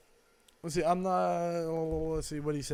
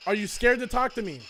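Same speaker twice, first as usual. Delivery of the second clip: calling out to spirits. Acted. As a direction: call out into an empty room. Asking the spirit box questions.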